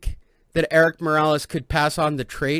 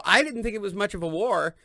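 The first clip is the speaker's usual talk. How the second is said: ordinary delivery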